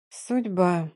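soft, weak single word; squeezed out. A narrator's reading. Heroine leaning on a tree, softly squeezing the word out. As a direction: force it out with effort. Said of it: "только и смогла выдавить из себя я".

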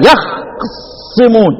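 يخصمون very slowly, vowelless خ held straight into a doubled ص; two sukuns joined. Shown as a demonstration. Teacher showing the hardest reading, laboured double-sukun recitation. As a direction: stop on yakh, then press a long ص in يخصمون. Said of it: "اجمع لي بقى بين الساكنين".

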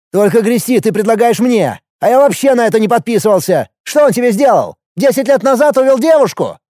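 high, agitated arguing; heated, exasperated challenge; indignant protest. A narrator's reading. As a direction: push back hard, pitch climbing. Brother protests in a quarrel.

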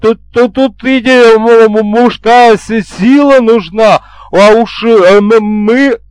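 high, loud, insistent stammering; eager stutterer. A narrator's reading. Interpreter offering male strength to the bride, eager stutter. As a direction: stammer loudly, pushing to volunteer. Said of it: "резко проснулся Заикин вместе с воодушевлённым Еремеевым".

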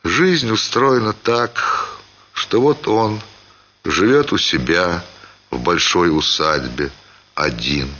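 ordinary delivery

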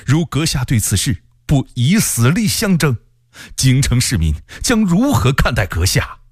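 high, impassioned appeal rising to a peak; very agitated plea. A narrator's reading. Censor friend urging the general to fight, very agitated plea. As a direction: plead urgently, pitch climbing to the last line. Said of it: "非常激动地说".

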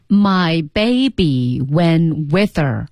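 very slow, drawn-out repetition; slow read-aloud practice. Shown as a demonstration. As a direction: stretch every word for learners to follow. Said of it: "来读啊". (很慢地拉长每个词跟读)

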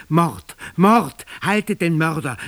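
loud alarmed shout; voices shouting. A narrator's reading. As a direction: crowd calling after the killer. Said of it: "riefen Stimmen hinter mir her".

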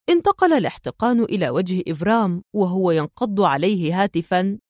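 ordinary delivery

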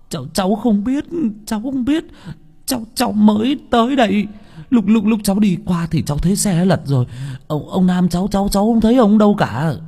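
very high, halting frightened voice; frightened stammer. Acted. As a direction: stammer it out, scared stiff. Frightened young villager questioned.

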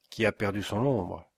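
plain reading voice

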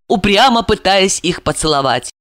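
usual speaking voice

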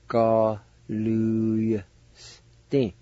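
drawn out Ga-lu-yas-tin, each syllable held very long with a faint Y; slow syllable-by-syllable Galusti. Shown as a demonstration. Teacher sounding out Ga-lu-yas-tin very slowly for learners. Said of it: "You just kinda pronounce the Y sound like this".

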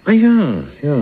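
the penny drops out loud; sudden realisation. Acted. Cellmate catching the Cain and Abel joke, leaping up in pitch. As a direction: pitch leaps high on "Ah ja".

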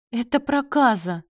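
high, loud wail of horror; lamenting. A narrator's reading. Mother lamenting her son's confession, wailing. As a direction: cry out as if disaster struck. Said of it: "запричитала Галина".

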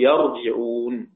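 يرجعون as "yar-ji-'ūn", a then i; yarji'ūn, active form. Shown as a demonstration. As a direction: say يرجعون with fatha on the ya, kasra on the jim. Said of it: "بالبناء للفعل".

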